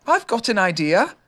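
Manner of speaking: mildly excited; sudden idea. A narrator's reading. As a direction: blurt out the idea. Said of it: "cried Christopher Robin suddenly".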